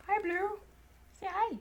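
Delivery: high-pitched coaxing greeting; pet talk. Real, not acted. Owner getting the lizard to say hi, pet talk.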